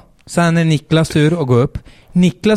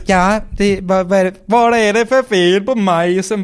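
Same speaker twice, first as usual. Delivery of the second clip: mock far-off shout; 8 semitones higher than usual. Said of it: "någon ropa så här".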